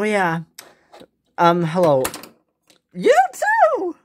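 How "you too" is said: high excited voice. Pretend. Doll greets excitedly.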